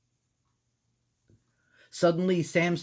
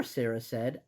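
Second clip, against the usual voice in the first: ordinary delivery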